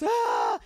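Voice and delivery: high mock crying "ah"; imitated child's wail. Parent imitates children left in the room, mock wail. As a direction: wail like a kid left alone.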